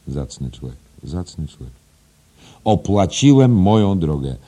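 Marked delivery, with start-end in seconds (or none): 2.6-4.4 loud, high, forceful declaration; proud boast. Acted. Headmaster repeating the boast to his teacher, loud and proud. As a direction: proclaim it with insistent pride.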